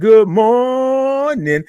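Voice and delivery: long sung-out "good morning" drawn high and slow; drawn-out morning show greeting; stretched good morning. Shown as a demonstration.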